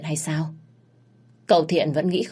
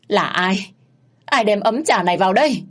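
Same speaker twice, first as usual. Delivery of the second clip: louder, higher outraged demand; bitter furious cry; 4 semitones higher, 5 dB louder than usual. Acted. Mother demanding who brought the tea, outraged. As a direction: shriek out the question in fury. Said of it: "rít lên đầy cam phẫn".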